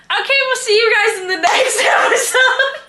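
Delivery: high, loud laugh through the goodbye; laughing sign-off. Real, not acted. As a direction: break up laughing while signing off. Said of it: "You guys should see Sandra's face".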